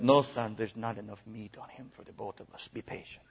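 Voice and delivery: hushed, lightly voiced cannibal father accent. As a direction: quietly play a cannibal dad with a faint accent. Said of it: "That's my best cannibal accent".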